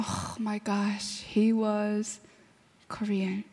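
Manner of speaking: slow, soft and low; hushed, halting shock; hushed dismay. Retold or imitated. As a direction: let it sink in, almost under the breath. Retelling the shocked reaction to news.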